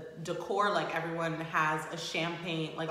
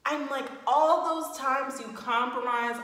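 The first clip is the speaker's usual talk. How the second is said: ordinary delivery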